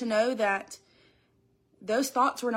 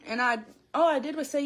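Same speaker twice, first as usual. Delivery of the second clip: ordinary delivery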